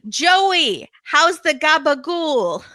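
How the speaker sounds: high, loud Italian-American buddy calling out; slow, hammed-up Italian-American greeting; mock Italian-American guy on the phone. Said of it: "with this guy's accent".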